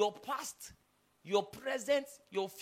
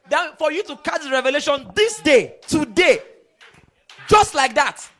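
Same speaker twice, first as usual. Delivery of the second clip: shouted high and loud as Jesus; booming declaration; 5 semitones higher, 13 dB louder than usual. Acted. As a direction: proclaim it like a verdict from the cross. Jesus voiced, climactic declaration.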